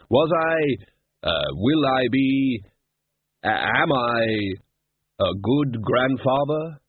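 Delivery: halting, slow, unsure Death; hesitant Death. Acted. Death asks Susan about himself, slow and halting. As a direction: stumble over the tenses.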